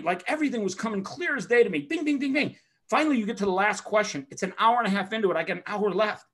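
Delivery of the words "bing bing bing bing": rapid-fire dinging. Retold or imitated. Imitates answers coming quickly.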